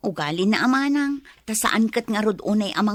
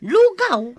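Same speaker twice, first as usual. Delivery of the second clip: incredulous question; 7 semitones higher, 6 dB louder than usual. Acted. Mother complains about porridge meal.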